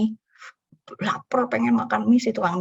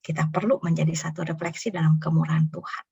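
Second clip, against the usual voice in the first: usual speaking voice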